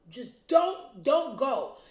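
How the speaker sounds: high, loud, exasperated plea; emphatic pleading. Pretend. Vlogger begging Gayle not to go, loud exasperated plea. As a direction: raise the pitch and push each "don't".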